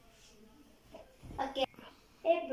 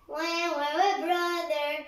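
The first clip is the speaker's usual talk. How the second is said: mock crying wah; slower than usual. Sister teasing her crybaby brother, sung mock wailing. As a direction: sing the wahs like a crybaby.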